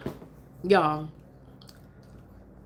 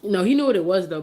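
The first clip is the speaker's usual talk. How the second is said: ordinary talk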